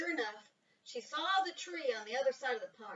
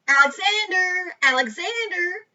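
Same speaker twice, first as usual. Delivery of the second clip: calling loudly across the park; 7 semitones higher, 14 dB louder than usual. A narrator's reading. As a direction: call his name out loud, higher. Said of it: "she called".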